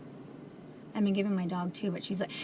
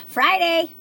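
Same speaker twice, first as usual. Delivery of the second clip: gasp of alarm; 6 semitones higher, 7 dB louder than usual. Real, not acted. Suddenly remembers hair appointment.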